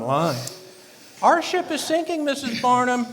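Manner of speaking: high, agitated outburst over money; worked up. Acted. Husband despairs to his wife over the failing store, worked up. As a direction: hammer it out, pitch jumping high. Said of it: "don't get so worked up".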